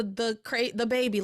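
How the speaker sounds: ordinary talk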